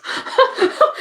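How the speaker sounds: very high, breathy burst of laughter; squeaky laugh. Real, not acted. Embarrassed on camera after a burp, squeaky laugh. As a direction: giggle high in surprise at yourself.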